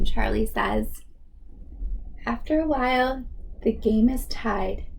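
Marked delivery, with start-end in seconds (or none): none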